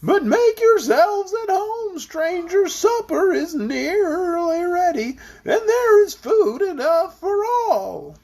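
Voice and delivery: high, slow voice bobbing up and down; bumpy voice. A narrator's reading. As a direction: make every word wobble over bumps. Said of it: "said in a rather bumpy voice".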